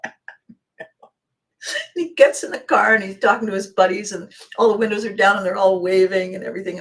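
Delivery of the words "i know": breathless whisper. Retold or imitated. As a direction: whisper it in giddy awe. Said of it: "And I'm going".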